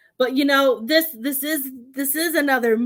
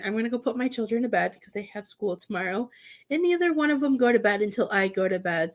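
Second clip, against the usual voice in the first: ordinary talk, nothing marked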